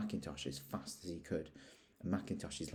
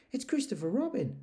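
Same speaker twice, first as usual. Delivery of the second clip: much higher bright bear voice; eager, pleased to see him; Pooh, eager and glad; eagerly; 4 dB louder than usual. Acted. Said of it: "said Pooh eagerly".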